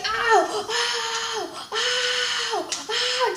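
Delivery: high drawn-out "ow" wails; mock screaming in pain. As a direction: imitate someone howling in pain, high-pitched. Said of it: "Just screaming in pain".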